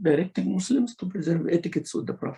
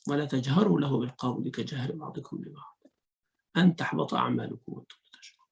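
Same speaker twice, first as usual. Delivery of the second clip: usual speaking voice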